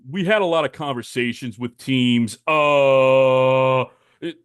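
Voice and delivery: lightly voiced GM reading a flat statement; mock the manager's stiff press talk; a hint of a dull press conference voice; imitating Nick Krall. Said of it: "That's, that's real Nick Krall quote".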